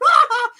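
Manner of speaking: very high, loud mock cackle; over-the-top villain laugh. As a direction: imitate a hammy heel's laugh. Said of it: "his laugh is just so over the top".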